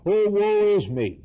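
high, loud acted lament; retold cry. Isaiah on seeing the Lord, retold cry of woe. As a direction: cry it out as the stricken prophet. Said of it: "and I cried".

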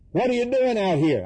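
high, lightly acted challenge; mock questioner. Onlookers asking John in the wilderness, mock questioner. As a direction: imitate an onlooker putting a pointed question.

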